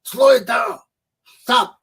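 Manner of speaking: high, loud command to halt; imitated boss yelling to stop; boss cutting in. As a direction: call out sharply to slow someone down. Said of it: "My boss will say".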